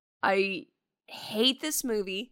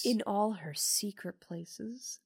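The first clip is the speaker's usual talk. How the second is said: hushed, softer creepy murmur, lightly voiced; creepy whisper; 8 dB quieter, slower than usual. Retelling the whispering patient luring the fiancé, hushed and creepy. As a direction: lean in and beckon in a hushed, eerie voice. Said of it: "a whispering character".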